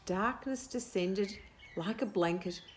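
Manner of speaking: plain reading voice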